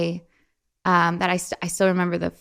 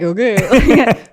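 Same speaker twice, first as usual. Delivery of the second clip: mock dad's okay; 3 semitones higher, 9 dB louder than usual. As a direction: imitate a dad going along with it. Imitating dad's reluctant reaction.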